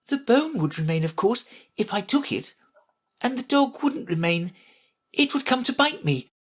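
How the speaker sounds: put-on high, reasoning girl voice; considering Alice. Alice working out the Queen's puzzle, considering. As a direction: think it through in a high voice.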